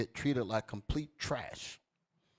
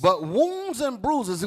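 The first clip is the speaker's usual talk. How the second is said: louder, higher repeat; preached echo; 9 semitones higher, 9 dB louder, much slower than usual. Shown as a demonstration. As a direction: throw it back to the crowd. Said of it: "Come on".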